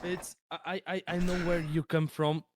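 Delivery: normal talking voice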